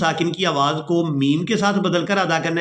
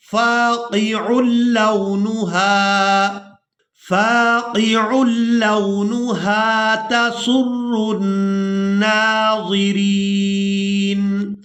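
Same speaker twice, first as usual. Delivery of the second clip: slow, chanted tajweed reading; Quran recitation; 3 semitones higher, slower than usual. Shown as a demonstration. Teacher chanting the verse before explaining it, melodic recitation. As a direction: sing the verse out slowly. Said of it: "اب یہاں پر آپ لوگ علامات وقف".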